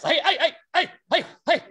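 rapid string of high, loud huffs; sharp little burst of air on every jab; boxer's jab breaths. Shown as a demonstration. Coach imitating boxers throwing jabs, quick sharp exhales. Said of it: "Boxers do this all the time with their jabs".

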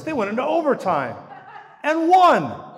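loud, high and laughing; joyful outburst. Real, not acted. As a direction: burst out with delight. Joyful football tie-in.

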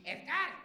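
"Edgar" called high and loud as "Eth-gard"; mom's shout of "Edgar", TH in the middle. Acted. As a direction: holler "Edgar" with a TH for the D. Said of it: "With a T H".

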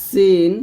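সিন like English "see"; thin, sharp seen. Shown as a demonstration. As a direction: hiss a thin s into a long সিন. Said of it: "সি এর মতো চিকন আওয়াজে".

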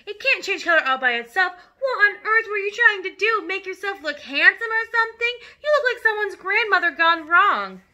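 high, loud indignant scolding; scolding mother. Acted. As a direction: tear into him like an exasperated wife. Mother scolds dyed-hair father.

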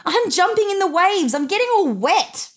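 put-on high, excited child shouting to his parents. A narrator's reading. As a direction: call out thrilled from the waves. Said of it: "came up for air and shouted".

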